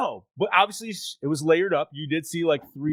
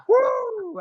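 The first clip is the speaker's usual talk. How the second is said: excited whoop; 3 dB louder than usual. Real, not acted. Celebrating fixed title.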